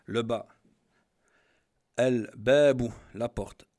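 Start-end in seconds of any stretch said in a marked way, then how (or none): 2.0-2.9 very loud "Al-Babu" with a clear L; lam pronounced. Shown as a demonstration. Teacher giving the ba example, loud with L sounded. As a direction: belt out "Al-Babu", L sounded before the B. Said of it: "on prononce le lem".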